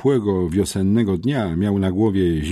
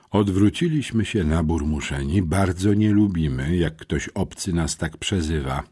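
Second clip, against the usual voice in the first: ordinary delivery, nothing marked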